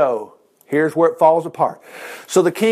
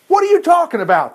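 demanding; 9 semitones higher than usual. Acted. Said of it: "he demanded".

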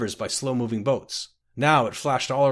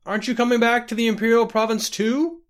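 calling out; 11 semitones higher, 5 dB louder than usual. Acted. Calling from departing carriage.

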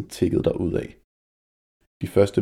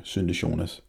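ordinary delivery, nothing marked

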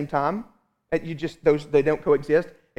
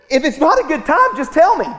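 daughter's voice; 9 semitones higher, 8 dB louder than usual. Retold or imitated. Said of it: "she said".

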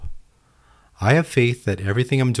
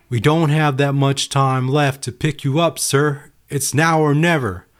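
higher, louder urgent plea; urgent rescue crew; 6 semitones higher, 3 dB louder than usual. Acted. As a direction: press him hard, no time left. Rescue crew urges the man.

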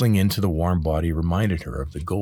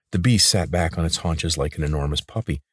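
ordinary delivery, nothing marked

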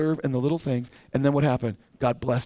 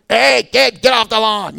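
mock cranky old man shouting, higher and louder; 4 semitones higher, 6 dB louder, slower than usual. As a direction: bark it like a grumpy neighbour chasing kids. Cranky old man yelling at kids.

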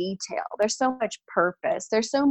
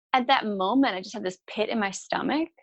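ordinary talk, nothing marked

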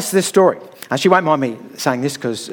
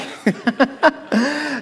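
laugh; 9 semitones higher than usual. Real, not acted. Laugh after "backed away.

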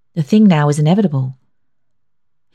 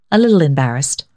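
plain reading voice

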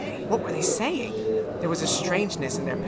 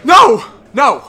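anguished scream; 5 semitones higher, 14 dB louder than usual. Acted. Daryl screams.

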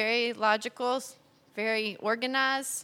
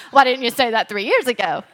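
laughing retort; 10 dB louder than usual. Retold or imitated. Quoting herself, laughing.

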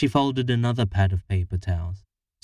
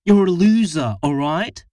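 loud, high cutting snap; wife snapping at her partner, loud and high; snapped insult; 8 semitones higher, 6 dB louder than usual. A narrator's reading. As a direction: spit the insult out at him. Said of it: "snapped Harriet".

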